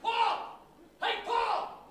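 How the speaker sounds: high yelling call; calling a dog. Retold or imitated. Shop lad calling his dog Paul, high yell. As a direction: yell the dog's name across the street.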